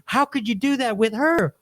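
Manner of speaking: wife accusing her husband over the mistress; upset wife. Retold or imitated. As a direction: pitch it up and plead. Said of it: "She's like".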